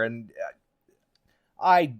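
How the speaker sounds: ordinary delivery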